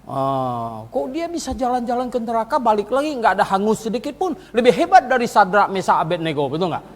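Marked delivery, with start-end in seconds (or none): none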